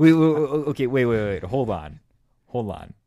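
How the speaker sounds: loud, rapid-fire string of waits; incredulous interruption. Real, not acted. Co-host halts friend's travel story, incredulous. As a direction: cut in loudly to halt the story.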